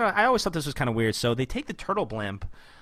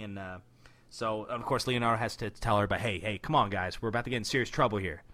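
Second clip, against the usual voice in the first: ordinary talk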